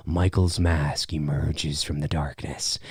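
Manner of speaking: low, slow, hushed menace; spooky storyteller hush; ominous reveal. Acted. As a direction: creep it out softly and low. Spooky telling of killer's appearance.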